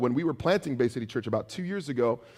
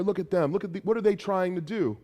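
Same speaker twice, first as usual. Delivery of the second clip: higher, jeering taunt from below the wall; mocking taunt; 6 semitones higher than usual. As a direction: sneer up at the builders. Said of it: "And he's mocking them".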